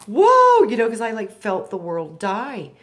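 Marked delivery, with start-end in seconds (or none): 0.1-0.6 much higher, much louder stunned cry; shocked whoa. Retold or imitated. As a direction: gasp it out in disbelief. Retold shock at world dying.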